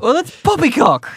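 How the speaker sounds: put-on a weak stab at a high, posh-sounding British accent; bad British accent. Co-host attempts a British accent on air, badly. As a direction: try a British accent and miss it a bit. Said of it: "that wasn't my best".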